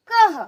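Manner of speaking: कह blended into high, loud "kaha"; कह short, ending on breath; visarga breath. Shown as a demonstration. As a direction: punch कह with an h release. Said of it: "तो कैसे बोलेंगे".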